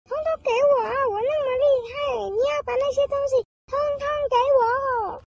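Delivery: high, fast, bragging squirrel shouts; gleeful shout. Acted. Squirrel brags to class after winning, high gleeful shouting. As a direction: crow over the win like a spoiled child. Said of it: "他就高兴的大叫".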